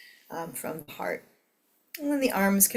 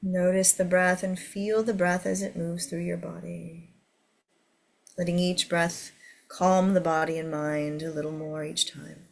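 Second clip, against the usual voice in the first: guided meditation. Acted. Leads a meditation.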